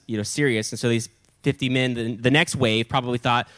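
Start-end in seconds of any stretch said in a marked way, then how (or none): none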